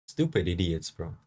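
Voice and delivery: normal talking voice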